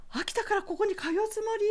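high incredulous question; teacher's voice. Retold or imitated. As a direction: sound amazed she'd commute.

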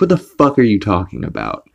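usual speaking voice

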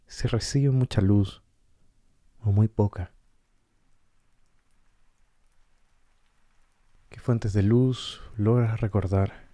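calm guided-meditation voice with long pauses. Acted. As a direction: guide listeners gently inward, leaving space between short phrases. Guía una meditación con pausas. (voz pausada de meditación guiada)

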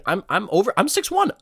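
ordinary talk, nothing marked

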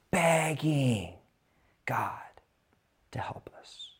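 soft whispered plea; a pastor whispering about begging God. Acted. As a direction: breathe the words out.

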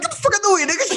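high-pitched mock yell; retold urgent yell. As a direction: act out shoving someone aside. Retold yell while shoving someone.

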